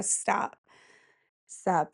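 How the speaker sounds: whispered stop. Real, not acted. Stunned by a town called Weed.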